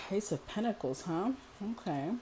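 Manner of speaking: talking while chewing gum. Real, not acted. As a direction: speak around a wad of gum. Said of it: "I'm sorry that I'm chewing gum in your ear".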